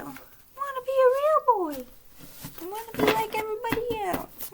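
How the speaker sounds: put-on be a little boy longing to be real; child wanting to be real. Medium voicing the child who loved Pinocchio, high and wistful. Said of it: "this kid related a lot to Pinocchio".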